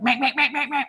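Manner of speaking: high, rapid-fire piglet noises; mad piglet squeal. Retold or imitated. Owner imitates piglet demanding at his cage, mad squealing. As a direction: imitate an impatient pig squealing to be let out. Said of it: "he's over at his cage and he's like".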